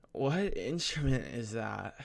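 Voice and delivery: put-on slow, drawn-out girl's voice; imitating a nervous girl. Student imitating a girl asking about the instrument, nervous and slow. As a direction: draw the question out as an awkward imitation. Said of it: "trying to accurately depict what happened".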